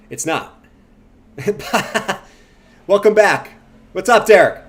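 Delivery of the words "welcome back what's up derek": loud greeting. Real, not acted.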